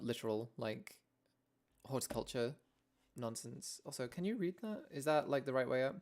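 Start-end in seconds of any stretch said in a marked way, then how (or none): none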